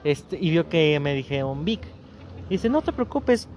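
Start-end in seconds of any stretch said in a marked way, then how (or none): 2.7-3.5 a pointed, clipped Vic; mock offended ex, higher; curt all-caps reply. Ex texting him, coldly offended. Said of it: "en mayúsculas".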